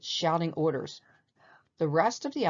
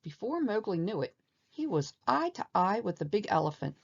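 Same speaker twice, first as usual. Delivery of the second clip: ordinary delivery, nothing marked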